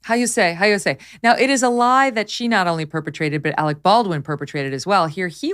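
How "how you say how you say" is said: mock Spanish accent. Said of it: "how do you say it?".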